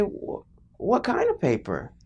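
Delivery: put-on high, hesitant stammer of a dreading kid; shocked child. Child asking dad about the paper, acted shocked dread. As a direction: stumble into the question, high and fearful. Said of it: "shocks me to hear this".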